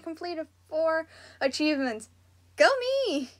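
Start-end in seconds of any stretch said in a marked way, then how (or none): 2.6-3.3 self-cheer. Real, not acted. Repeats her self-cheer.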